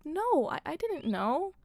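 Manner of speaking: retold timid denial. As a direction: imitate a small, defensive kid answering. Acting her startled answer to teacher.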